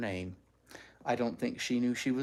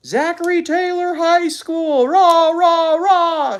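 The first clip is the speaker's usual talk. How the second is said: very high, very loud slow stadium cheer; cheering shout; 15 dB louder, slower than usual. A narrator's reading. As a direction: yell it like a carload of fans. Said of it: "shouted one carload of students".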